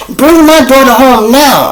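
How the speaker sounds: high, loud, shrill-free demanding mom voice; mother demanding her daughter home, high and loud; angry mother. Retold or imitated. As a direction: play the frantic mom yelling down the phone. Mother yelling on phone.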